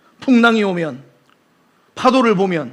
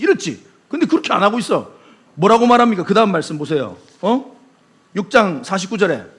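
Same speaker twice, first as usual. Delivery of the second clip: normal talking voice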